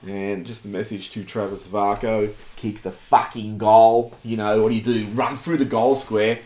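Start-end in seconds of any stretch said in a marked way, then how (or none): none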